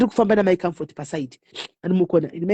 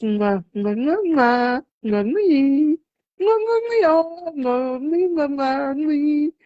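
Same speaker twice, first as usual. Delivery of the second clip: blowing kisses; 5 semitones higher, much slower than usual. Real, not acted.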